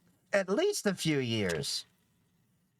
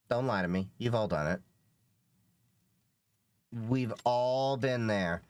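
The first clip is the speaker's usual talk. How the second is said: ordinary talk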